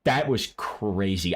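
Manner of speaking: ordinary delivery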